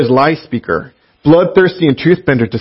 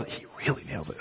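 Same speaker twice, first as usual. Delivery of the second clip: breathy emphatic whisper, much softer; 3 semitones lower, 23 dB quieter, faster than usual. Real, not acted. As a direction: drop to a hushed whisper to stress it. Emphatic aside after praising translator.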